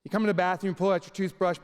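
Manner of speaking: ordinary talk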